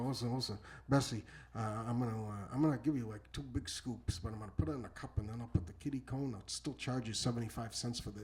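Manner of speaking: low, soft aside; conspiratorial near-whisper. Retold or imitated. Scooper slipping Bessie a secret deal, hushed. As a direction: confide it secretly to a customer. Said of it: "like, whispering to the side".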